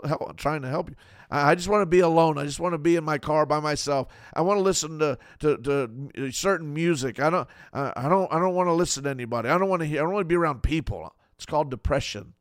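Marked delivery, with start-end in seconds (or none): 1.3-11.1 fast, louder petulant whine; sulky withdrawal. Retold or imitated. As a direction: push everyone away, sulking. Voicing a depressed believer.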